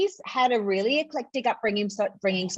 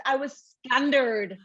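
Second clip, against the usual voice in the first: Northern Irish scundered; 3 semitones higher than usual. Shown as a demonstration.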